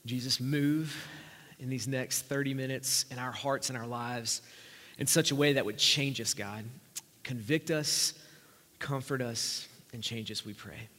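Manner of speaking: low, soft, measured praying voice; earnest prayer. Real, not acted. Preacher asks Jesus to move in hearts, earnest prayer. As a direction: pray quietly, pausing between pleas.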